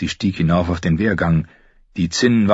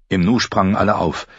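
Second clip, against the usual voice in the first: plain reading voice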